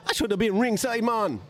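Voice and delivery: high-pitched bad Jamaican accent; hammed-up Jamaican indignation. Dillian griping about missing ringside, in a mock Jamaican accent. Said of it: "he turned into Rasta Mouse".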